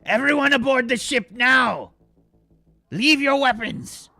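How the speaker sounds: high, loud commander's shout; urgent shouted order. Acted. Ichar orders the crew to abandon weapons, urgent shout. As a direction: shout the crew aboard in an emergency.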